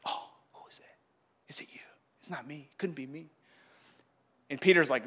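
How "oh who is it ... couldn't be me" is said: very soft, nervous whispering; hushed, suspicious asides; furtive whisper. Acted. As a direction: whisper it to the one beside you. Disciples furtively ask each other.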